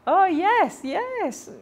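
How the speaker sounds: high, loud, bluffing "oh yes, yes"; fake agreement. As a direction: nod along high-pitched, pretending to understand. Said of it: "It's like".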